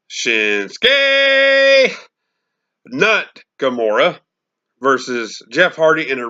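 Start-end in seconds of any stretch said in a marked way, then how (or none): 0.1-4.2 long, drawn-out high name call; ring announcer call. Pretend.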